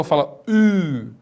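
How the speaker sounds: U said slowly with lips pushed forward, French u; pursed French U. Shown as a demonstration. Teacher coaching the student in French u, lips pursed. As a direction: pout the lips for a long French u. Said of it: "do biquinho".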